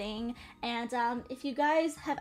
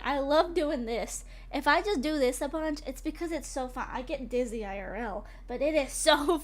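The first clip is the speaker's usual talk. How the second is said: usual speaking voice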